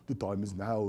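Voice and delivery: low, soft attempt at a Belgian accent; half-hearted Belgian-accented guru, hushed and low; murky Belgian accent impression, dropped low; Eckhart Tolle. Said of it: "he says something profound in his Belgian ac-, accent".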